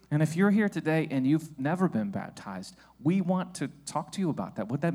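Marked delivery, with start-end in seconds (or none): none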